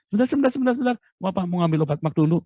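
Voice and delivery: high, loud hurried calling out; hammed-up wife crying wait wait; mock urgent wife. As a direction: playfully mimic the wife cutting him off. Wife interrupting date to take medicine.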